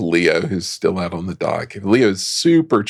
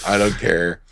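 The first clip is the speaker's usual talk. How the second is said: usual speaking voice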